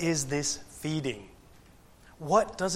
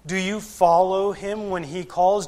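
ordinary delivery

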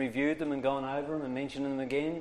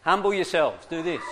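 higher, louder laughing urge; laughing coaxing; 4 semitones higher, 5 dB louder than usual. Real, not acted. Teacher laughing, coaxing the class to pucker up. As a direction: laugh while egging the class on. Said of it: "Get your lips, everybody now".

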